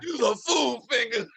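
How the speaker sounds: laughing shout. Acted. Men laughing at a joke.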